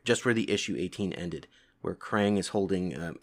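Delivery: plain reading voice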